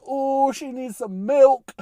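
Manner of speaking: high, drawn-out silly voice; put-on high meme voice; mock meme voice. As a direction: stretch it out high and slow, mock-concerned. Quotes a meme mid-story.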